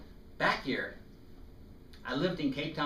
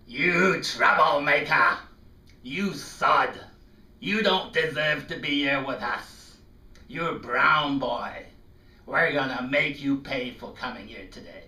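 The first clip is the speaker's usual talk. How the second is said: louder, slow hostile taunt; angry boy; 2 semitones higher, 8 dB louder than usual. Retold or imitated. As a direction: threaten like a resentful schoolboy. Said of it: "I thought he said".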